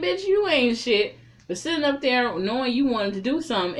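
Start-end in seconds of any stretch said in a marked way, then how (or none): 0.0-1.2 high, loud, slow clapback; sassy comeback. Pretend. Talking back to the jealous friend, loud sassy clapback. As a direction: put her in her place, loud.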